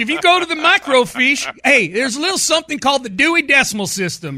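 put-on high-pitched lecturing old-timer; hammed-up old geezer explaining the library; old man impression. Mocking the coach's age with library talk, old man impression. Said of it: "How old does he sound".

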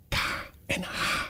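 unvoiced hushed whisper, letter by letter. A narrator's reading. Alice naming what the mouse hates, whispering. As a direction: whisper it, half afraid to offend. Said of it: "voegde ze er fluisterend aan toe".

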